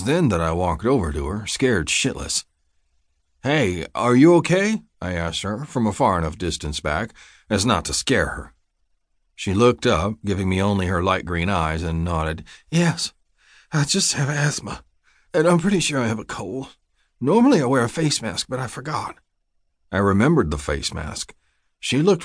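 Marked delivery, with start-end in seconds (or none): 3.4-4.8 raised, rising check-in; concerned call. A narrator's reading. Narrator's man checks on wheezing woman from a distance, concerned call. 12.7-19.2 higher-pitched woman with asthma; lightly lifted voice of an unwell woman; ailing woman's voice. A narrator's reading. Sick woman explains herself to worried man, ailing higher voice.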